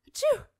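a huge high sneeze bursting out; loud sneeze. Acted. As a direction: sneeze explosively mid-boast. Cold returned with a vengeance.

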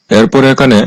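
normal talking voice

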